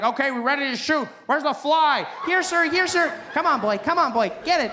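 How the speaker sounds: bossy director calling the shots; a pushy set director ordering the crew; film director. Retold or imitated. Director on set barking orders at the trained fly.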